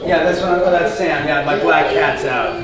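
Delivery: normal talking voice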